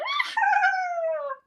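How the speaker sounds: very high, loud cackle; witch cackle. Pretend. Voicing witch cat for toddler, shrieking high cackle.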